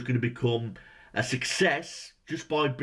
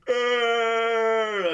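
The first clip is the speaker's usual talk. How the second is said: loud, higher mock pained roar; mock groan; 8 semitones higher, 5 dB louder than usual. Critic imitating Marv under the bookshelf, mock roar. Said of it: "lies on the floor and goes".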